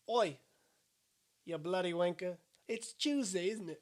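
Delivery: mock British street banter; British accent. Shown as a demonstration. Showing his friend a British voice on request, British accent. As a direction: put on a cheeky British accent. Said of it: "Do a British voice".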